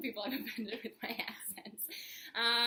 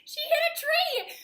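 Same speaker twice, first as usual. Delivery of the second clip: excited; 10 dB louder than usual. Real, not acted. Laughing at friend's crash.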